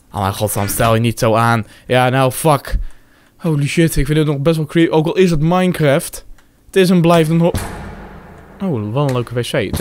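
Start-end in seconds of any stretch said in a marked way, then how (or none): none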